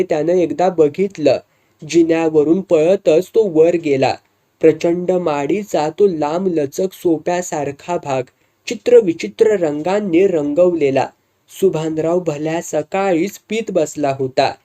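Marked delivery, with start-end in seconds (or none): none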